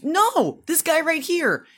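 put-on a kid pointing out the wrong car; daughter insisting. Daughter insisting on the sedan, high childish voice. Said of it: "She goes".

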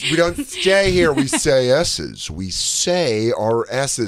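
"S's" modelled slowly and deliberately; dialect coach. Acted. Dialect coach drilling pupils on their S's, slow demonstration. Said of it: "this is your dialect coach".

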